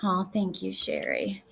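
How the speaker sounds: ordinary delivery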